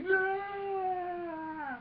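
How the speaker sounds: very high, sustained scream; hammed-up falling villain's scream; Emperor. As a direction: shriek it and hold it long. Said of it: "the Emperor's like".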